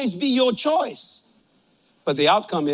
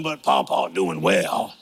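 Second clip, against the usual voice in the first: sly drawl; slower than usual. Retold or imitated. Grandkids' sly remark.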